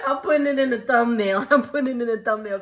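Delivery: quoted through laughter; laughing quote. Retold or imitated. Recapping the reunion for viewers, laughing while quoting. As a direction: laugh out the words.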